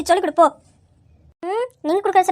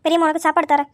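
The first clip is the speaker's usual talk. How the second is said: normal talking voice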